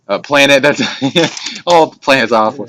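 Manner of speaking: high, loud laughing words; laughing at his own phrase. Real, not acted. Speaker cracks up at his own wording, laughing.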